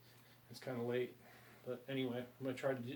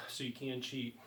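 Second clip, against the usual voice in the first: ordinary talk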